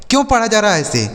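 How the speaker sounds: ordinary talk, nothing marked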